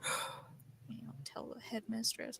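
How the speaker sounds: unvoiced slow whisper. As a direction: whisper the threat slowly. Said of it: "character threatens quietly".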